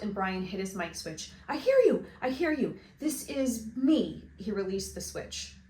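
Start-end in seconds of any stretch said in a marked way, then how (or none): 1.5-2.0 high, loud burst of relief; excited relief. Acted. Boy answers radio excitedly. 2.2-4.2 a little higher, slower, eager; calm down and confirm contact; eager reply. Acted. Boy answers radio.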